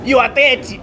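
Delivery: ordinary delivery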